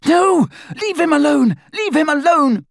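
high, loud desperate scream. A narrator's reading. Hermione begging attackers to spare Ron, screaming. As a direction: scream it high, pleading for a friend. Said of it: "Hermione screamed".

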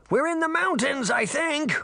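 put-on high boyish shout over wind; Puck shouting. A narrator's reading. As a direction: call it out against the storm. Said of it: "he shouted".

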